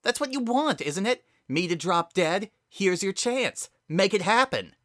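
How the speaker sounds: high, loud bitter taunt, slowing on "Here's your chance"; bitter, sharp. Acted. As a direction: dare her, voice cracking with bitterness. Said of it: "a sharp edge in her voice".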